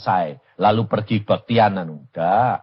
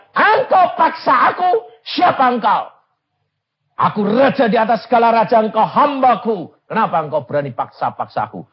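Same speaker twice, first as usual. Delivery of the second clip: high, loud wrathful voice of God; retold divine fury, shouted; angry God; 12 semitones higher, 7 dB louder than usual. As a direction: rebuke the servant as the king of kings. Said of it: "dia marah luar biasa".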